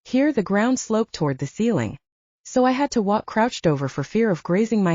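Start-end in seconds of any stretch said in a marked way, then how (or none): none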